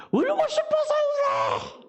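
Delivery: put-on high, slow whiny child's cry; a sulky little girl wailing back; defiant child. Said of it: "얘기했더니". (울고 싶어서 떼쓰는 아이 목소리)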